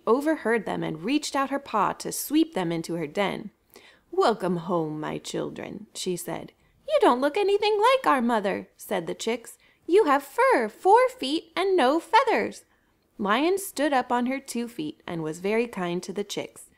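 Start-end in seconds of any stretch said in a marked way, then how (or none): 4.1-5.9 lower, softer purring lion; sly lion. A narrator's reading. Lion luring the lost chicks in, low and sly. 6.9-12.7 higher, piping little chicks. A narrator's reading. Chicks telling Lion she is not their mother, high and piping.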